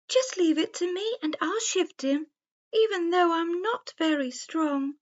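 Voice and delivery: high, eager little deer voice; a dainty deer bravely offering to help; sweet young deer. Acted. The roe deer speaks.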